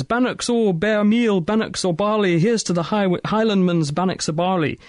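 high-pitched mock Scottish recital; terrible Scottish accent. Presenter voicing the third patient in a joke, bad Scottish accent. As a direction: declaim it in a hammed-up, badly faked Scottish accent. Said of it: "my terrible attempt at Scottish accent".

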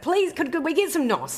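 high pleading voice. Retold or imitated. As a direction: beg for the gas. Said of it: "I was like".

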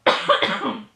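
one hard cough to clear tea; deliberate throat-clearing cough. Real, not acted. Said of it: "let me just cough".

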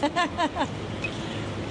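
short amused laugh. Real, not acted. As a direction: laugh at the joke. Officer laughs at driver's joke.

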